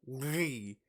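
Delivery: "Lee" with a lingering, effortful L; struggling L sound before the "ee"; attempting Italian "gli". Shown as a demonstration. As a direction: try the Italian palatal L on "Lee". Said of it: "It's so hard to, like, do".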